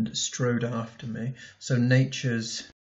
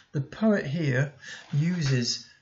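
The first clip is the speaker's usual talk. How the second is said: ordinary talk, nothing marked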